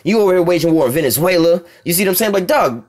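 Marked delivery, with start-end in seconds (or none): none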